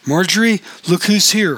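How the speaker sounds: high, loud call through the house; hollering. A narrator's reading. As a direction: holler to someone in another room. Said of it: "hollered George".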